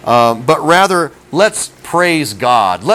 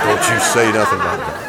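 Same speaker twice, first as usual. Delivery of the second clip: low stern warning; 6 semitones lower, 3 dB quieter, faster than usual. Retold or imitated. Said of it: "'cause it's like".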